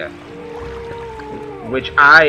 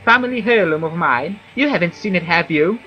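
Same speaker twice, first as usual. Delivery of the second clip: higher whiny villager voice; Fargoth; 6 semitones higher, 4 dB louder than usual. Acted. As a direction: voice a fussy whiny elf. NPC Fargoth dialogue voiced.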